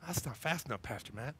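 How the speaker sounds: "That's not fast enough" pulled down low and soft. Acted. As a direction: sulk it under your breath.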